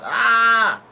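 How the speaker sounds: high, loud, held "ah" yell; yelled cry. Retold or imitated. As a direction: imitate a character's drawn-out scream. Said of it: "yells out".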